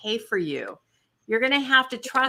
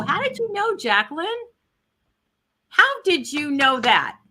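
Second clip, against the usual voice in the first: surprised; 7 semitones higher, 4 dB louder than usual. Real, not acted. Surprised at the viewer's guess.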